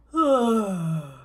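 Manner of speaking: loud sigh, tongue stuck out. Shown as a demonstration. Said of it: "do that with your tongue out".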